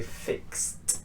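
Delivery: "fixed" ending in a voiceless t. Shown as a demonstration. Teacher modelling X verbs, T ending. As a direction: say "fixed" as one syllable, "fikst". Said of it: "an X ending".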